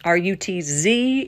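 normal talking voice

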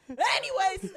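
high, loud imitation; mock blurted line. Mimicking the script for the cast, mock loud blurt. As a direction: throw it out loudly, laughing. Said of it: "The points were just like".

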